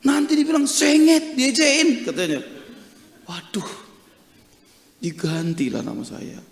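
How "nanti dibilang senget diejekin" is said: acted grandparent's objection, high and loud; worried protest. Grandparent warning the pastor about teasing, worried protest. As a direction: plead the grandchild's case, pitched up.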